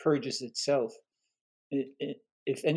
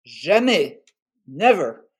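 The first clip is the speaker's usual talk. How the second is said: emphatic refusal; 2 semitones higher, 11 dB louder, slower than usual. Retold or imitated. Ho's sudden firm refusal.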